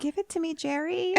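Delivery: high, soft swooning plea; playful mock pleading. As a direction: coo it up high, hammed-up.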